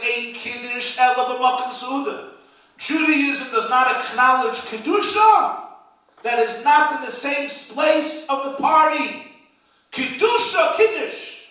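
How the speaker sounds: rising preacher's fervour; fiery preaching. Acted. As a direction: thunder it from the pulpit. Preaching at full force.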